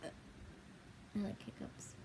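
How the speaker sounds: slow, barely audible whisper. Real, not acted. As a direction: breathe it out very slowly. Very soft confession.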